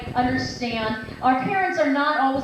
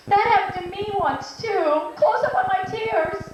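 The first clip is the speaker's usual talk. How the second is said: high, hammed-up empathetic talk show host; Oprah; 7 semitones higher than usual. Host sharing a guest's problem, mock-tearful. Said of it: "Should I be like Oprah".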